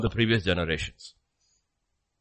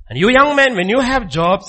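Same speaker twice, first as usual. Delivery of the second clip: loud call; 10 semitones higher, 9 dB louder than usual. Real, not acted. Preacher shouting to the young men in the congregation, loud. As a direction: raise the voice to address them.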